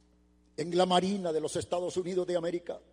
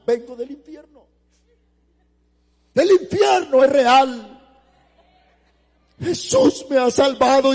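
high, loud, slow testimony, imitating weeping; retold tearful cry; 7 semitones higher, 13 dB louder, slower than usual. Survivor telling friends of hell, imitated tearful shout. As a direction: cry it out urgently, loud and drawn-out. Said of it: "dice que les decía llorando".

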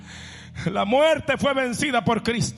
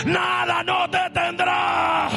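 preaching at full force; 9 semitones higher than usual. Acted. Pastor proclaiming to the congregation, preaching at full force. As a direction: preach it out to the whole church.

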